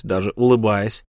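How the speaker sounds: usual speaking voice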